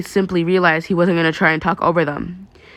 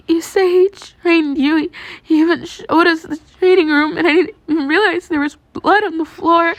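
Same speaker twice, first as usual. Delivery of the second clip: high, louder, sobbing words; tearful girl; 11 semitones higher, 3 dB louder than usual. Acted. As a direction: cry it out into a shoulder. Held voice of Yaomomo, face hidden.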